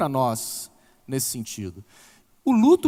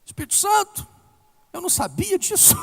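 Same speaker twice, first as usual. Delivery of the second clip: much higher, loud, hammed-up astonishment; mock amazed God; 8 dB louder than usual. God reacting to her honest prayer with hammed-up loud surprise. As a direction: play God comically shocked by the news. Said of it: "Deus olha e fala assim".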